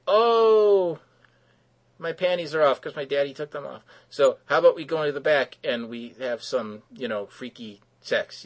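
hammed-up falsetto flirt; mock seductive damsel. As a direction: play the heroine purring a come-on. Sapphire propositions Rexy.

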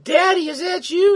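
very high, loud falsetto question; hammed-up damsel falsetto; mock girly squeak. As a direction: squeak it like a frightened little girl. Sapphire grabbed by the monster.

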